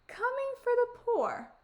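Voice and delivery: very high, wondering voice; high-pitched storybook old-woman musing. Acted. Befana muses over the boy's words.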